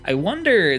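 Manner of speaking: ordinary delivery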